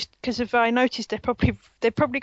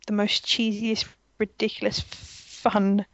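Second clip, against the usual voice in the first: ordinary talk, nothing marked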